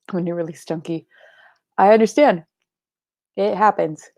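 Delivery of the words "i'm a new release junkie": hushed whispered confession. Real, not acted. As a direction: whisper it to yourself. Whispered aside.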